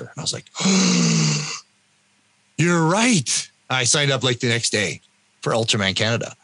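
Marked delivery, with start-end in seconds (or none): 0.5-1.6 long, high intake of breath; sharp gasp. Retold or imitated. Acts out sudden realization. 2.6-3.5 higher-pitched, thrilled agreement; amazed realization. Retold or imitated. Acts out being won over.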